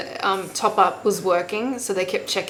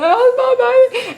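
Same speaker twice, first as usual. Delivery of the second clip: high, loud crying wail; 11 dB louder, slower than usual. Retold or imitated. Mother retelling crying on the operating table, high sobbing wail. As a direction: cry out for the baby as if it breaks you. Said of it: "crying, like".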